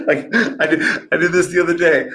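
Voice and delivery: chuckling through the words; laughing. Real, not acted. Laughing as he starts the hotel story.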